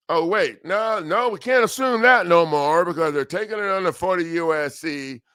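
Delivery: normal talking voice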